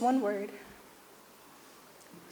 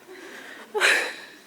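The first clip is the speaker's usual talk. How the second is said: a breathy burst of laughter, pitched up; high airy laugh; 10 semitones higher, 4 dB louder than usual. Real, not acted. Granddaughter laughing at her own eulogy joke, airy laugh. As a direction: laugh off the joke about being dubbed the dumb one.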